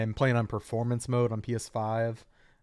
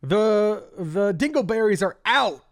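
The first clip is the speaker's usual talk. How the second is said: esports commentator; 8 semitones higher, 5 dB louder than usual. Shown as a demonstration. Mock esports commentator call.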